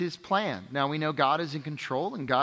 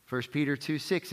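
ordinary delivery

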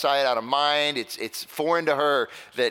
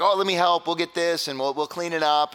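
eager offer, higher and louder; eager helper; 5 semitones higher, 4 dB louder than usual. Retold or imitated. Pastor offers guests help cleaning up, eager and upbeat. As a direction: jump in keenly to help.

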